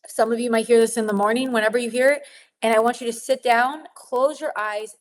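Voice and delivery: usual speaking voice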